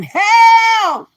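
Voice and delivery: high, loud mock cry for help; mimicking a drunk woman yelling help on a livestream; mocking holler. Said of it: "live over there hollering".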